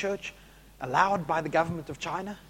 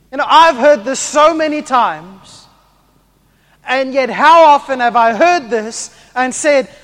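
loud, high preaching; full-force preaching; 8 semitones higher, 15 dB louder than usual. Acted. Preacher urging the church to make disciples, loudly. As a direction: drive it home to the congregation.

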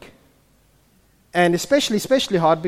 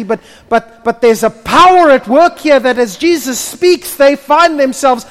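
high, loud preacher at full force; fiery preaching; 11 semitones higher, 13 dB louder than usual. Acted. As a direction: preach it to the rafters. Preaching builds to climax.

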